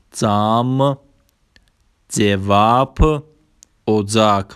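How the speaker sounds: Turkish example words said singly, drawn out; Turkish pronunciation demo. Shown as a demonstration. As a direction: model each Turkish word for learners.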